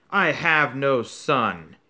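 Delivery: loud and slow, each word final; cold fury. Acted. Father disowning his son, loud and slow. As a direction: cut him off for good.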